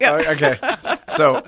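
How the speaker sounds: laughing through the words, voice jumping high. Real, not acted. Guest laughs at host back from break, laughing. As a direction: giggles spilling into the words.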